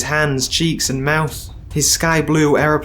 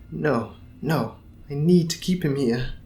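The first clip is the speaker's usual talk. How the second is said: softer private aside; inner thought; 7 dB quieter than usual. Acted. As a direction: mutter it inwardly. Sang-woo's inner thought.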